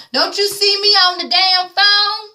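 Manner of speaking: put-on high, slow sing-song mama telling off her child; a mother on the phone snapping at her kid.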